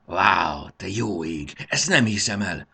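loud, wide-eyed wonder; amazed exclamation. A narrator's reading. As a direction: cry out in awe. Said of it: "kiáltotta Marci".

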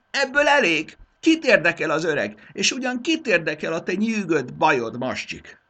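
high, loud furious outburst at the servant; snapping in anger. Acted. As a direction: cut him off, red-faced with rage. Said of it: "elvörösödve ripakodott rá Mascsikra".

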